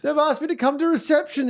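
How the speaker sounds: put-on high, anxious little girl; a kid telling mum she's in trouble; panicked child. Dad voicing his daughter to her mum, high child voice. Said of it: "she's like".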